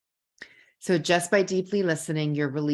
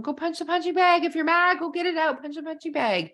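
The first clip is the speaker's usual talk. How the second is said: put-on much higher, energetic instruction to the kids; mom directing kids; 11 semitones higher than usual. Mom sending mad kids to the basement punching bag, energetic. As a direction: urge them on like a firm, upbeat mom. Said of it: "I'll tell them".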